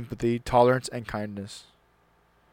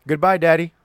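put-on higher, louder child's goodbye call; 6 semitones higher, 8 dB louder than usual. Son calling goodbye to his father, bright call. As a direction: call it across the room like a little boy. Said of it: "You turned and waved and called".

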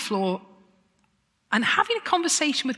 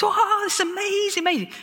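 much higher, thrilled exclamation; delighted gush; 11 semitones higher than usual. Retold or imitated. The crowd cheering the public proposal, thrilled and delighted. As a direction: gush like you can't believe it. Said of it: "but we were like".

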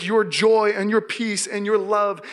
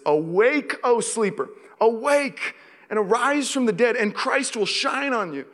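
scripture proclamation; 5 semitones higher than usual. Acted. Reading scripture aloud.

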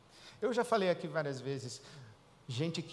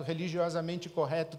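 usual speaking voice

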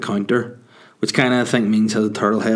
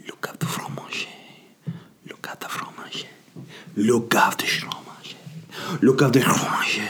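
breathy seductive whisper of "Le cave de fromage"; sexy whisper; 5 dB quieter than usual. Shown as a demonstration. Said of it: "very sexy".

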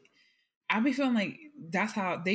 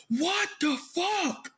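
imitating Nate; 9 semitones higher, much slower than usual. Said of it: "When he was like".

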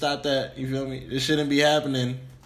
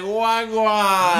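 put-on Jamaican accent; 7 semitones higher, 6 dB louder than usual.

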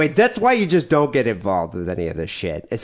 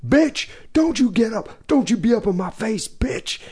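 high-pitched imitation of an angry yell; mock yelling; 10 semitones higher than usual. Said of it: "And was yelling at her".

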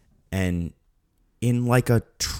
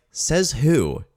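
raised-pitch sceptical challenge; mock indignation; 5 semitones higher, 4 dB louder than usual. Viewer talking back to a castaway's forecast, mock indignation. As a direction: push back, incredulous and scornful.

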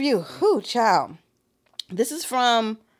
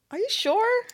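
usual speaking voice

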